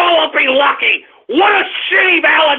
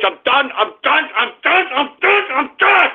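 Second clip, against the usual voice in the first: mounting rage; 3 semitones higher than usual. Real, not acted. Host quits his show, rage building with each repeat.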